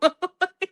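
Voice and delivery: a high delighted laugh; bright laugh. Real, not acted. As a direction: laugh at your own story. Laughs at end of story.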